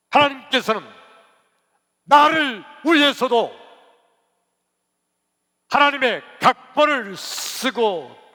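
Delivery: loud, high, impassioned preacher; full-force preaching. Real, not acted. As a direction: thunder it out in bursts with pauses.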